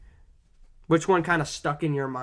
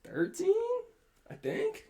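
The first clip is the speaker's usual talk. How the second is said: unsure rising; 3 dB quieter than usual. Real, not acted. Unsure guess of points.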